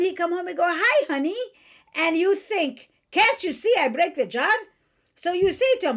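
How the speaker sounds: put-on mother's Chaldean accent. Mother warning daughter about husbands, in her Chaldean accent. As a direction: lecture like a Chaldean mother in broken English. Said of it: "And in her accent".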